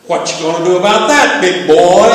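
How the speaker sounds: put-on loud teasing challenge, rising on "big boy"; teasing, joking. God ribbing the heart patient about surgery, teasing and joking. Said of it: "he spoke to me in a teasing, joking tone".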